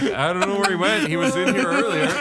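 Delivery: high, laughing fake innocence. Real, not acted. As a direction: giggle through the cover story. Friends covering, laughing.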